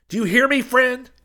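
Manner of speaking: very high, loud demand; impassioned yell. A narrator's reading. Preacher demanding that silent Hayes hear him, impassioned yell. Preacher calls through the car.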